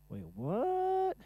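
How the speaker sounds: high squeaky double take; shocked confused yelp; surprised wait what. Real, not acted.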